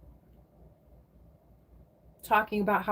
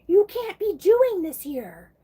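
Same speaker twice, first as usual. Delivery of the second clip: even higher and louder, slow furious scold; angry and mad; 11 semitones higher, 8 dB louder, slower than usual. Retold or imitated. Old lady scolding the petition woman, louder and higher in anger. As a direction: repeat it louder, really losing it. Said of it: "And angry and mad".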